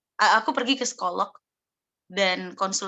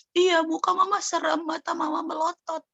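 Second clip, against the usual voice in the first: put-on a small child's answer, pitched up; child's voice, higher; 8 semitones higher than usual. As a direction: say it like a six-year-old telling mum. Anak menjawab mama. (anak kecil menjawab mama, suara lebih tinggi)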